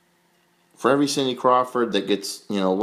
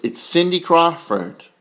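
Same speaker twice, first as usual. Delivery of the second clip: ordinary delivery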